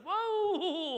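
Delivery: long, high, loud whoa; hammed-up alarm; alarmed halt. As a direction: cut in to stop them, drawn out. Reuben halting his brothers.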